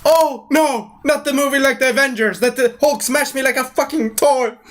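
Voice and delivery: high, dismayed inner monologue; mock panicked thought. Loki remembering Hulk smashing him, mock panic. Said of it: "he's thinking in his head".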